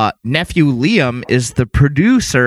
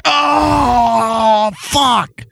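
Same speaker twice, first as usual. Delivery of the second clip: dismayed shout; 9 semitones higher than usual. Real, not acted. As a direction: cry out in shocked sympathy. Reacting to a painful story.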